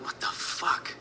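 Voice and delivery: whispered disbelief, barely voiced; hushed "what the fuck" in shock; stunned whisper. Shock at doll from dad.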